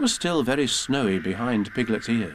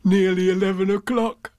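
raised, cheerful bear voice; happy Pooh; 8 semitones higher, 4 dB louder than usual. Acted. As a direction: say it brightly as Pooh. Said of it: "said Pooh happily".

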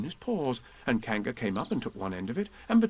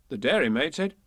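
usual speaking voice

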